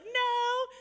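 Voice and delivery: very high, loud tearful denial; mock crying. Tearful player answering her coach, mock crying. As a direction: imitate a crying player denying it. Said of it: "and she starts crying".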